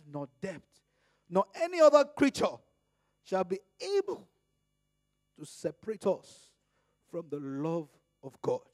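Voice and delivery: climactic pulpit reading of Romans; preaching scripture. Acted.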